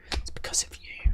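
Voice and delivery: hushed whisper. Pretend. Echoing the wrestler's line to chat, a whisper. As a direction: breathe it like a secret.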